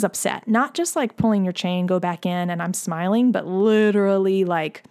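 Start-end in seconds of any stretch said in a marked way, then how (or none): none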